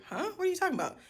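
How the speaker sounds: high, bewildered huh and question; like you have no idea what she means; confused surprise. Retold or imitated. Confused reply to relative's claim.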